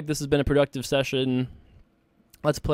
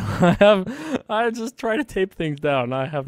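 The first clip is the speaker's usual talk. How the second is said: laughing; 9 semitones higher, 7 dB louder, slower than usual. Real, not acted. Laughing about the desk.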